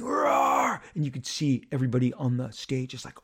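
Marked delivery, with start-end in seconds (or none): 0.0-0.8 high, drawn-out crowd roar; imitated crowd scream. Fan retelling the crowd's reaction, high imitated roar. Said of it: "just screaming".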